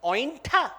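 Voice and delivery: mildly appalled one-word question; horrified disbelief. Acted. Lakshman recoiling from the bitten fruit, mild horror. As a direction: query it as if recoiling. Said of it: "recoils in horror and says".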